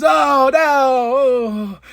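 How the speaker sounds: high, loud mock cry of dismay; hammed-up dismay. The Bucks faking shock at the wreck, hammed-up cry. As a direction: overact the horror like bad actors. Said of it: "trying to be actors here".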